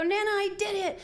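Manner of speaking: high, loud gleeful shout of success; excited child. Retold or imitated. Grandson shouting to Nana about his game win, excited. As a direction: cheer like a little boy who finally won. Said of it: "you're gonna go".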